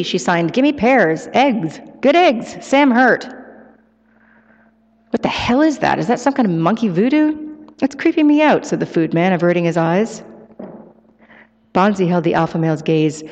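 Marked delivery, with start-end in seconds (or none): 0.5-3.3 high, emphatic bonobo requests; signing bonobo demanding. A narrator's reading. Ape signing demands, read in character. 5.1-8.6 high, rattled exclamation; unnerved food man. A narrator's reading. Food man reacts to signing, alarmed.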